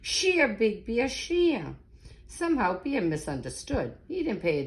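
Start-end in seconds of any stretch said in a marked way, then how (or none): 0.0-1.8 slow, drawn-out call rising high on shear; tree's call. Acted. Old oak tree calling to Bear, a lightly voiced slow call. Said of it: "A deep, low voice said".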